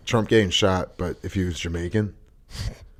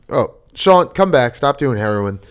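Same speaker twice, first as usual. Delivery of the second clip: mock call-out; 5 semitones higher, 7 dB louder than usual. Calling out to old co-host.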